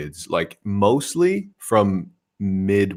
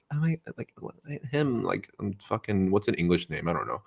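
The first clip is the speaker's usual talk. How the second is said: mock American girls; 8 dB quieter than usual. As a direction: imitate a dazed girl gushing over a boy. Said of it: "all the American girls are just like".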